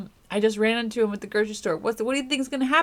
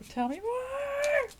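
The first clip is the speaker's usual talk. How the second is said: teasing; 6 dB quieter, slower than usual. Pretend. Teasing the cards.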